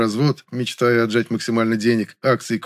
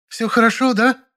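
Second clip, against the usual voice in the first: high-pitched outraged female character voice; hysterical cry; 12 semitones higher, 4 dB louder than usual. A narrator's reading. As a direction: challenge in a high, frantic voice. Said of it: "закричала она не своим голосом". (истеричный крик высоким голосом)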